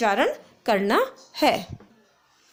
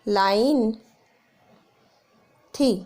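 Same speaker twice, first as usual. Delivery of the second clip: slow dictation; much slower than usual. Shown as a demonstration. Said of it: "dictating question word by word".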